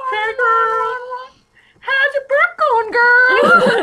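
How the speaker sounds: very high, loud, slow squeal; high squealed valley girl; gushing valley girl. Pretend. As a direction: gush at her like a best friend.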